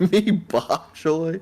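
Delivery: put-on very slow, drawn-out Jamaican accent; mock Jamaican accent. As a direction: stretch it out like a bad Jamaican impression. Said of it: "in a Jamaican accent".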